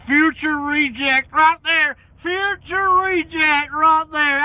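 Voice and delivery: high loud slow mock-announcer line; hammed-up hype. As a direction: stretch it out like a big announcement.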